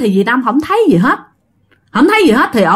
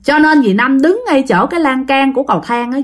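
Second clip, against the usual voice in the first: ordinary delivery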